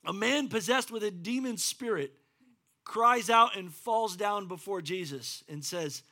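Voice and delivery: normal talking voice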